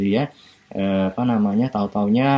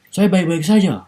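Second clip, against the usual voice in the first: loud and higher, forceful but not angry; a booming, high reply that only sounds like anger; loud blunt reply; 9 semitones higher, 6 dB louder than usual. Retold or imitated. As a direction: answer with a loud, strong tone, as in the lecturer's Makassar or Batak example. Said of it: "nadanya bicaranya keras seperti orang Makassar".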